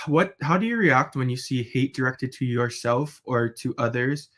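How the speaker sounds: ordinary talk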